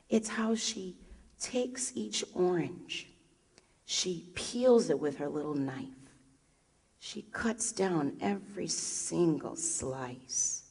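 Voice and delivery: slow, soft, savoring each step; slow hushed telling. Acted. Telling the audience how the vendor peels oranges: slow and hushed. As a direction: draw it out slowly and quietly.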